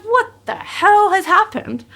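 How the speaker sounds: high, slow, stunned "what the hell"; stunned disbelief. Retold or imitated. To Kate hanging out the ute window, high stunned disbelief. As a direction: pitch up and drag it out in shock. Shocked at friend waving from ute.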